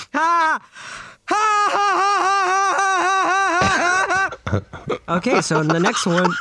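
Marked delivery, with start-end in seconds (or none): 0.1-5.2 deliberately fake, drawn-out cackle; forced fake laugh. Host imitates a Final Fantasy character, forced laugh. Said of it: "Titty Man from Final Fantasy ten".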